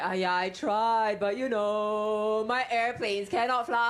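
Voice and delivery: slow, sheepish Singlish excuse. Acted. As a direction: mumble it like a guilty ex. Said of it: "And you're like".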